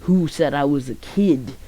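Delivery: slow, lightly put-on big-guy voice; imitating a big form. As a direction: play the transformed big guy, drawn out. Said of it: "Gon goes to his big form".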